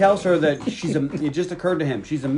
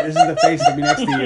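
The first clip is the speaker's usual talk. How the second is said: teasing; 5 dB louder than usual. Real, not acted. Teasing with a face, both laughing.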